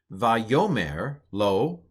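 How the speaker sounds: "Vayomer lo" said slowly, va-YO-mer lo; Hebrew pronunciation. Shown as a demonstration. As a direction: sound out "Vayomer lo" syllable by syllable. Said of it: "here's how I would pronounce it".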